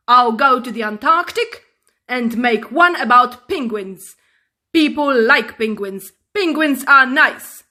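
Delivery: loud angry telling-off; loud indignant rant; furious presenter. Acted. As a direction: scold the cub loudly. Man storms off.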